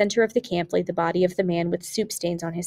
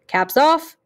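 higher, louder barked command; sudden shouted order; 9 semitones higher, 5 dB louder than usual. A narrator's reading. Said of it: "lager test suddenly shouted".

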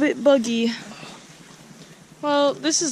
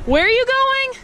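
calling out; 13 semitones higher, 4 dB louder than usual. Real, not acted. Repeated call to friend.

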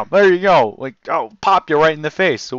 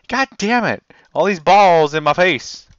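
ordinary talk

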